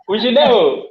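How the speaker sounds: ordinary talk, nothing marked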